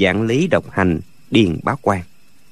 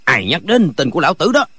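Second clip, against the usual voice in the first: mild raised voice, pitched up; loud call; 5 semitones higher than usual. A narrator's reading. Villain in the next room demands who named him, calling out. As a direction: call out roughly, only moderately loud. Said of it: "ở phòng bên la to".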